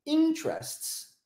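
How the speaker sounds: "interests" slowly, high rise on IN, then falling; first-syllable stress. Shown as a demonstration. Teacher showing English stress to Brazilian students, first-syllable stress on "interests". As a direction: lift the start of "interests" high and draw it out. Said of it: "a maioria no início".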